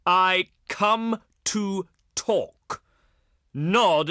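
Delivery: very slow, word by word; condescending. Acted. Envoy to someone who seems not to understand, condescending. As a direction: spell it out slowly, pausing after each word. Spelling it out for a dolt.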